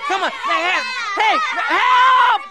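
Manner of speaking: very high, loud cries for help; panicked yelling. Acted. Storyteller attacked by kids' stinky socks, panicked yelling. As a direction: shriek in comic panic as they close in.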